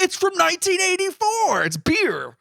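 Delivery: hammed-up high squeal of discovery; excited discovery. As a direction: cry it out like an astonishing find. Acting out characters' excited discovery.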